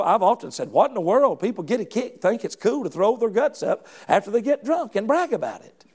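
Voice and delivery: ordinary delivery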